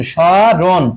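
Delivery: "স্মরণ" drawn out slowly, sho then ron; slow pronunciation demo. Shown as a demonstration. Teacher spells out the answer for students, slowly drawing out "স্মরণ". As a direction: stretch "স্মরণ" very slowly in two parts. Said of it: "আর কি হবে?".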